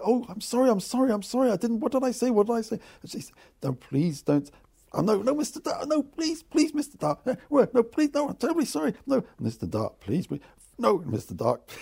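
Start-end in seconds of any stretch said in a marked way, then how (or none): 0.0-2.8 much higher, rushed mock-panicked sorries; patient apologising to nurse, high and flustered; flustered apology. Retelling his flustered apologies. 3.6-11.7 much higher hammed-up frantic pleading; pleading nurse.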